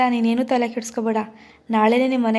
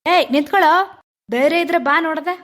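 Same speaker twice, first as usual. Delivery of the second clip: higher, louder shout after him; angry challenge; 4 semitones higher, 4 dB louder than usual. Acted. Shivu shouts a challenge at the fleeing goon, loud and angry. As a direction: call him back to fight.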